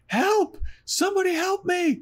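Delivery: high, desperate cry of help from far away; high-pitched mock scream for help; off-screen scream for help. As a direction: shriek it like someone in danger. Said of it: "someone screaming".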